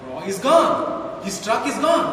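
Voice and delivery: ordinary talk, nothing marked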